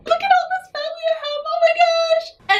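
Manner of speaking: high, squealing rush of delight; gushing over all this family; overwhelmed joy. Real, not acted. Grateful mom marveling at her family, squealing with joy.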